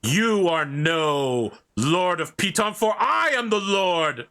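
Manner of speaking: stomp forward and claim your title; furious lord. Acted. Knight stomping toward the players, furious. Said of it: "He begins stomping towards you".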